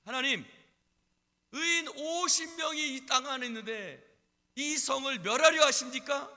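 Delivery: loud, high appeal to God; Abraham bargaining urgently with God; pleading prayer. Acted. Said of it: "아브라함이 하나님 앞에 기도했습니다". (높고 크게 호소하는 기도)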